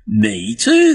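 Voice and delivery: loud, high exclamation of happy disbelief; hammed-up, high-pitched surprise at the coincidence; amazed delight. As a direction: play the astonished drinker in a pub joke. Acting out joke's amazed drinker.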